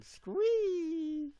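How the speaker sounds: high, loud fangirl squee; excited squeal. Real, not acted. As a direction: squeal it out with delight.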